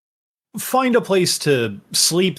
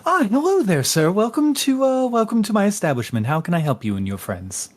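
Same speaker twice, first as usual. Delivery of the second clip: high, polite innkeeper welcome; customer service voice; 8 semitones higher, faster than usual. Acted. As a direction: greet them sweetly like a hotel clerk. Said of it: "in the nicest customer service voice".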